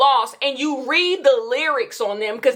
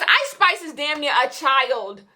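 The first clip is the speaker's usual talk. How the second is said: ordinary delivery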